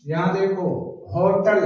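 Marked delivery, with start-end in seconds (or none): 1.1-1.6 "होटल" with the H audibly pronounced; sounded H. Shown as a demonstration. Teacher contrasting hotel with silent-H words, H sounded. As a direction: start "होटल" on an H before the O. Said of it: "एच जो silent नहीं है".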